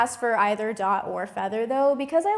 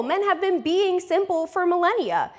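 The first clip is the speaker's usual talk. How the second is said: ordinary talk, nothing marked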